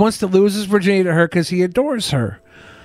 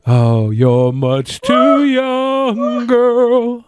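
slow mocking voice; 3 semitones higher, much slower than usual. Mock scolding.

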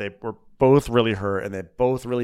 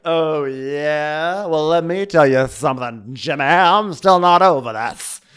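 put-on old Hollywood dame at the bar; mock old-movie tough talk; forties movie star; 3 semitones higher, 3 dB louder, slower than usual. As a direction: play a hammed-up forties film star, stretching the last line. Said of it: "like, old forties movie star".

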